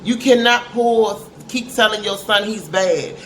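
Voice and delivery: ordinary talk, nothing marked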